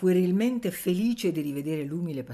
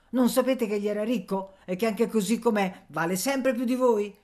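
high, loud protest defending him; indignant outburst; 5 semitones higher, 4 dB louder than usual. A narrator's reading. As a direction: snap back at the old man. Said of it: "Nanna si ribellò".

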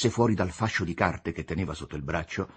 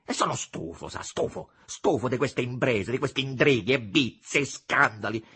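exasperated, rising outburst; fed-up vexation; 8 semitones higher, 4 dB louder than usual. A narrator's reading. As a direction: build from weary to annoyed. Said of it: "non riuscendo a frenar l'impeto della stizza". (sfogo esasperato di chi è stufo)

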